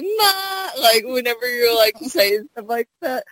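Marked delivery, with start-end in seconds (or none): 0.0-0.7 high-pitched imitation Southern belle; teasing Southern belle impersonation; Southern belle accent. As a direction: mimic a Southern belle calling her ma. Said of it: "Southern Belle accent when you're like".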